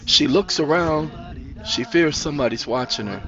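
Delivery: ordinary delivery, nothing marked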